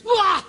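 short high yelp imitating a scream; imitated shriek of a man thrown to the floor; mock scream. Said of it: "the guy screams".

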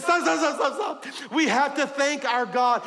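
high, loud cry of stop; urgent halt. Acted. David's men stop the march to thank God, crying out. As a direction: call the procession to a sudden halt.

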